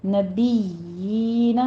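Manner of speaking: نبیین with the یا held two counts, lips flat; recited نبیین with a long یا; long yaa. Shown as a demonstration. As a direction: draw out the یا in نبیین. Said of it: "یا کی آواز آپ نے کھینچنی ہے".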